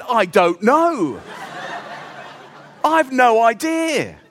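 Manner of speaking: slow, laughing fake innocence; sheepish laughing denial; mock innocent through laughter. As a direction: pretend you know nothing, giggling. Feigning innocence after draining battery.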